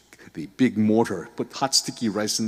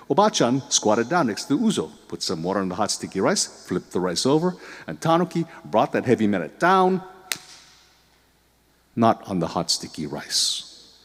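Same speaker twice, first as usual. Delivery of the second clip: plain reading voice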